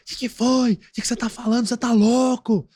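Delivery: high-pitched, loud angry outburst; acting out someone angrily raising pitch and volume; genuine anger demo. Shown as a demonstration. Expert shows how rage raises the voice, angry outburst. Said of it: "A pessoa fala mais alto".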